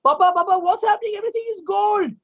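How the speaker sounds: put-on young princess calling out in shock; alarmed princess. A narrator's reading. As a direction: call to father in alarm. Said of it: "came running".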